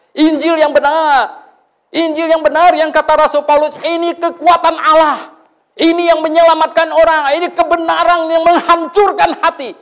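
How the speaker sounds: loud, high, ringing proclamation; fervent preaching. Acted. Preacher proclaiming the true gospel to the congregation, loud and fervent. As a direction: proclaim it to the whole church.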